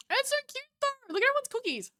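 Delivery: high delighted coo. Real, not acted. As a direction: gush over something adorable. Admiring the cookies.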